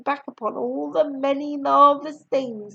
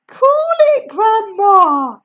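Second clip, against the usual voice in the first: put-on higher, louder boy's voice; George; 9 semitones higher, 8 dB louder, slower than usual. A narrator's reading. George calming Grandma, higher louder voice. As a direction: tell her to calm down. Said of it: "George said".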